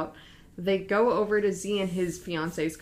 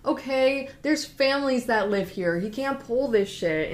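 higher, retold exasperated sputter; exasperated scolding; 6 semitones higher, 3 dB louder than usual. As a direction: scold in acted disbelief. Z reacts angrily to jump.

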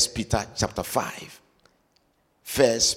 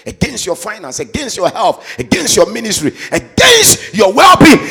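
building preaching; 8 semitones higher, 12 dB louder than usual. Acted.

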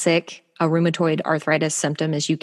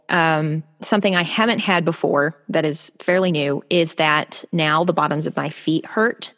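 ordinary talk, nothing marked

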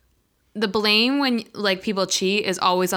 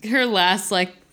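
ordinary talk, nothing marked

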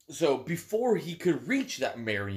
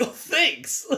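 naive voice; 11 semitones higher than usual. Retold or imitated. Naive leader thanks poisoners.

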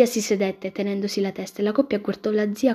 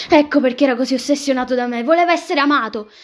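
loud, high revelation; sudden realization; 5 semitones higher, 7 dB louder than usual. Acted. Character suddenly grasps the judge's obsession, worked up. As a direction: burst out with the discovery, rising higher.

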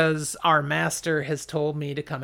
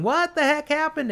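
yelling; 11 semitones higher than usual. Acted. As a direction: yell it half-deaf after the blast. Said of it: "he's yelling".

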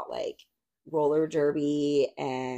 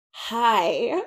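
imitating McDowell; 6 semitones higher, 6 dB louder than usual. McDowell's brief reply, laughing.

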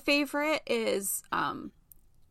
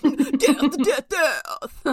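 laughing; 3 dB louder, much slower than usual. Real, not acted. Gleeful summary chant.

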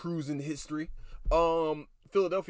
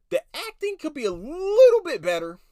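mock critic voice; 12 semitones higher, 10 dB louder than usual.

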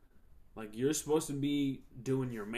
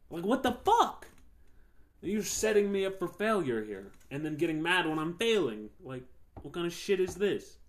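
higher and louder, exasperated rant; angry outburst; 5 semitones higher, 6 dB louder than usual. Real, not acted. Ranting to viewers about an unfair boss, angry and loud. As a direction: let the frustration boil over.

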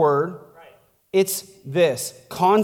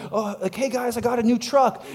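higher-pitched, lightly acted good news; a touch of pretend excitement; mock excited sharing; 5 semitones higher than usual. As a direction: imitate someone eagerly sharing news. Voicing proud truck owner to friends.

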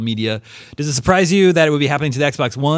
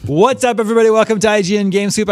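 loud, bright show opener; podcast host intro; 8 semitones higher, 5 dB louder than usual. Acted. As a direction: hype up the welcome like a host.

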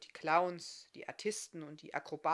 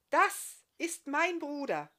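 laut, hoch und langsam ausgerufen; verkündet es stolz der ganzen Manege; begeisterter Ausruf; 8 semitones higher, 8 dB louder, slower than usual. Acted. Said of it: "rief sie begeistert". (begeistert laut gerufen)

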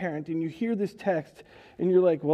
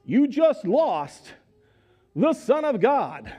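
slow, louder, each word punched; dramatic emphasis; 5 semitones higher, 4 dB louder, slower than usual. Real, not acted. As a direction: drive home the enormity. Preacher stresses parents' loss.

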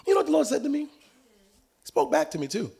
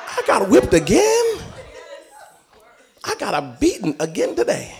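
louder, higher, slow outraged "again"; hammed-up indignant protest; laughing, exasperated complaint to God; mock disbelief; 10 semitones higher, 4 dB louder than usual.

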